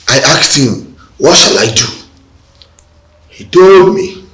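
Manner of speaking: loud, rising declaration; emphatic recounting. Acted. Husband reporting the seer's words to wife, loudly emphatic. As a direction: build to a loud, high proclamation.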